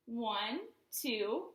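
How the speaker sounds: usual speaking voice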